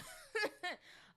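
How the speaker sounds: high burst of giggles; laughing hard. Real, not acted. Amused at her own promise, laughing hard. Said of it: "laughing so hard".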